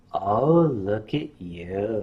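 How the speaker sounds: low, slow, softly voiced mother; mother admiring. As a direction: coo it low and drawn out. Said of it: "And she said".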